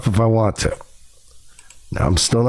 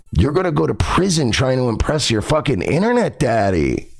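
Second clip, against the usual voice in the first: ordinary talk, nothing marked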